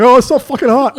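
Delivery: loud, high whine of complaint; exaggerated outburst about the heat; mock complaint. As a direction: imitate a friend woken by the sun, hammed up. Imitating friend waking in hot sun.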